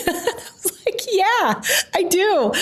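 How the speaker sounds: high-pitched, laughing through the words. Real, not acted. Woman recalling her yes to the job offer, laughing. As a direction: crack up while saying it, voice way up.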